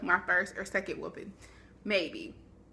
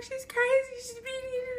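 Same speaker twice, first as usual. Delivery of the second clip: put-on panicked kid. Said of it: "And I'm like".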